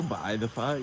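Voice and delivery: ordinary talk, nothing marked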